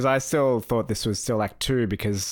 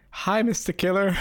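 high, cheery, naive hello; chirpy greeting; 10 semitones higher than usual. Retold or imitated. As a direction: greet the killer brightly. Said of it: "he's like".

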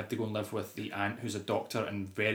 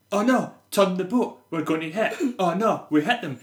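mock alarm, slightly hammed up; raised, half-hearted panic; kids' bad acting; 7 semitones higher, 6 dB louder than usual. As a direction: imitate wooden child actors in a crisis. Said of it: "two kids in the boat are like".

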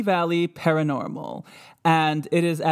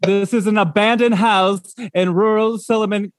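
mock indignant; 3 semitones higher, 6 dB louder than usual. Mock scolding the ghost.

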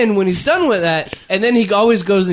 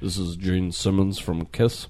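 low, soft announcer drawl; 10 semitones lower, 8 dB quieter, slower than usual. Retold or imitated. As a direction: growl out a rock star intro. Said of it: "They get to be".

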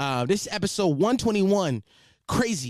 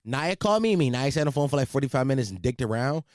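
normal talking voice

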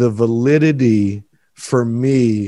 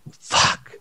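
choked-up curse. Real, not acted. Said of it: "I'm fucking crying right now".

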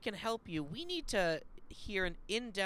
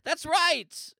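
big loud ad-host exclamation; hyped sign-off; excited ad shout; 8 semitones higher, 9 dB louder than usual. Acted. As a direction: punch it like a pitchman.

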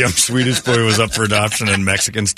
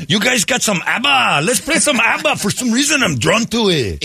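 high, sing-song hint of Swedish; mock Swedish accent; 9 semitones higher than usual. Swedish adoptee asking for ABBA, light mock Swedish lilt. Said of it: "why do I feel like you're doing an accent".

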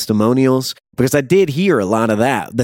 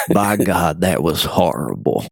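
mock low voice; 7 semitones lower, slower than usual. Reviewer recalling bathroom reaction, low mock disgust. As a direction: drop it low like a stunned critic. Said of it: "and I said".

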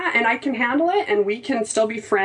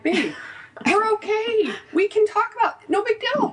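high, sweet soothing voice; sing-song reassurance to a frustrated husband; 12 semitones higher than usual. Retold or imitated. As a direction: coax him gently like a child. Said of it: "But I'm like".

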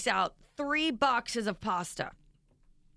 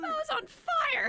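much higher, slow voice, laughing through the words; slower than usual. Real, not acted. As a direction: squeal it out mid-laugh. Laughing at the spicy chicken.

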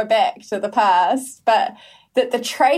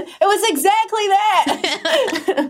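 laughing; 10 semitones higher, much slower than usual. Real, not acted. Laughing at the statue comparison.

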